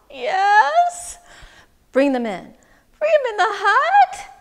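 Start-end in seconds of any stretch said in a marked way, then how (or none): 0.1-1.2 high, loud, wary answer; reluctant yes. Acted. Man admitting to wise woman he has cows, reluctant. 3.0-4.3 high, loud disbelief; incredulous question. Acted. Man to wise woman about her advice, incredulous.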